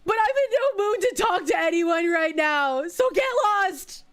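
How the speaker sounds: loud, high, furious brush-off; angry shout. Acted. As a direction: yell at them to leave. Character angrily sends player away.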